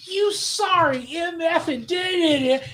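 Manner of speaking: high, slowed mock scream. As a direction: imitate a furious tirade without full volume. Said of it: "He jumps up and he screams".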